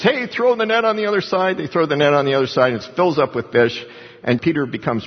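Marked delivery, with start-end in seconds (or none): 0.0-1.6 higher, called out across the water; calling out. Retold or imitated. Man on the beach hails the fishermen, mock call-out. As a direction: hail them from the beach, pitched up. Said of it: "cries out to him and says".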